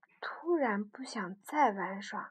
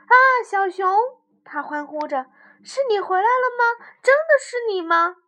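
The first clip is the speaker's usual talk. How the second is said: high, loud joyful shout; joyful cry; 10 semitones higher, 13 dB louder than usual. Acted. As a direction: cry out with joy.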